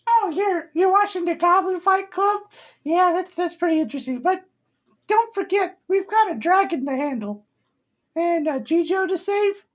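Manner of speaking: chatty, very high falsetto figure; small high voice reminding a friend of the task; squeaky adventurer urging them on; Ford. Acted. Game master plays Ford.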